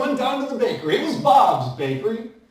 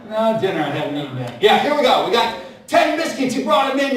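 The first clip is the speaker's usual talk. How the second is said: ordinary delivery, nothing marked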